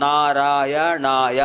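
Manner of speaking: "నారాయణాయ" drawn out very slowly, each syllable separate. Shown as a demonstration. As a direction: count out the five syllables of "నారాయణాయ". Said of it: "పంచాక్షరాలు".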